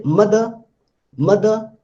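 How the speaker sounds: "mother" as MUH-duh, ending in a little schwa; little final uh. Shown as a demonstration. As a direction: finish mother on a short uh without r. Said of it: "that a little a is there".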